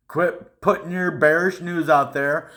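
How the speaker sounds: ordinary delivery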